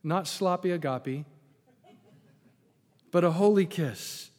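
holy said with weight and a lift; emphasis on holy. Shown as a demonstration. Preacher stressing holy to the congregation. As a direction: lean on the word holy. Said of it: "And I emphasize holy".

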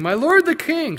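high, loud call across distance. Acted. David calls after the departing king. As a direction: call to him down the ravine. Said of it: "called out to Saul".

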